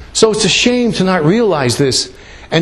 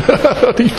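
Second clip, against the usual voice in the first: short laugh; 8 semitones higher than usual. Pretend. Imagining God laughing in hell.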